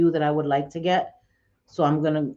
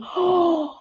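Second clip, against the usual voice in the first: gasp; 13 semitones higher than usual. Real, not acted. Gasp at the ruined lipstick.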